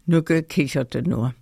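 usual speaking voice

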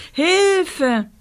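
long high cry of panic; screaming for help. A narrator's reading. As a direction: shriek for rescue. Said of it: "schrie Rosa".

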